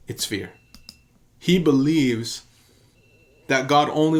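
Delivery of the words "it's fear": softer, lower second "fear"; a quieter, lower echo of "It's fear"; hushed echo. Real, not acted. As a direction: let "fear" sink into a hush. Repeated, softer still.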